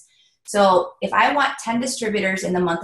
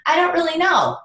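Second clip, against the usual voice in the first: imitating a clueless recruit, pitched up; mock the shrug of someone without a goal; high, blank I-don't-know; 9 semitones higher, 3 dB louder than usual. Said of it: "they're like".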